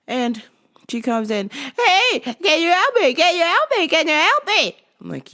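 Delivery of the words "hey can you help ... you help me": high, loud nagging plea; whiny customer. Retold or imitated. Pestering the clerk for help, high whiny nag.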